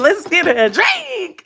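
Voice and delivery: very high, drawn-out mock excitement; eager squeal. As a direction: squeal it slowly, hamming up the eagerness. Acting out her eager answer.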